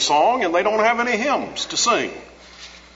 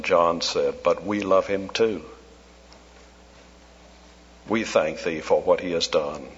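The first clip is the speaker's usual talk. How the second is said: closing prayer; 8 semitones lower than usual. Acted. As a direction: pray over the hall, gently.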